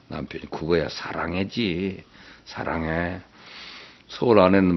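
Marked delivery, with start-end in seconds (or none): 0.5-3.2 tender reply. Acted. Husband's loving punchline to wife.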